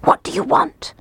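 high, whispered timid question; fearful whisper. A narrator's reading. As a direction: whisper it fearfully through the door. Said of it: "he whispered".

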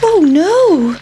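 high, small worried pancake voice; a little talking pancake, alarmed; pancake's voice. Acted. The pancake panics as the boys wait to eat it, high and worried. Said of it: "thought the pancake".

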